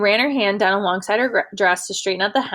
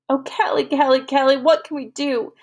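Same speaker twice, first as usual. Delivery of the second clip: higher, pleading and broken off; lightly voiced distraught girl; 7 semitones higher than usual. A narrator's reading. Girl pleading helplessly with her friend, mildly distraught. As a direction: let her fall apart a little. Susie breaking down.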